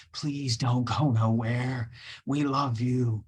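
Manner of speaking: ordinary talk, nothing marked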